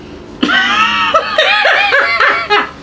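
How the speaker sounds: high shrieking laugh. Real, not acted. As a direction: burst out laughing. Husband's rude joke.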